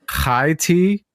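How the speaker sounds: "high tea" opened with a throaty 'kh', slow; slow, careful "high tea" as KHAI tea; guttural Hebrew-style ch. Retold or imitated. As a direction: rasp the first sound of "high" like the ch in Bach. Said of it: "you'd pronounce that high".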